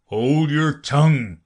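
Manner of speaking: loud, slow creature command; Gryphon. A narrator's reading. Gryphon silences Alice, loud drawn-out command. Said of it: "added the Gryphon".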